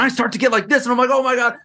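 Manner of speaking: high, rushed, over-energised camera persona; excitable. Retold or imitated. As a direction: act out getting wildly worked up on camera. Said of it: "it's, like, really excitable and a lot of energy".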